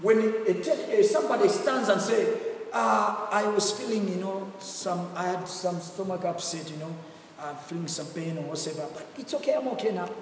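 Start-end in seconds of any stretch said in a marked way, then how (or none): 2.7-10.1 casual testimony. Retold or imitated. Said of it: "Even the way he talks".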